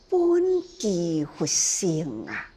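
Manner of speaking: ordinary delivery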